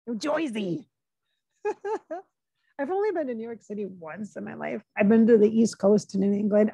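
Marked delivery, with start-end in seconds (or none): 0.1-0.9 put-on "Joisey" New Jersey twang; playful mock New Jersey accent. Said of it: "like, Jersey Mike".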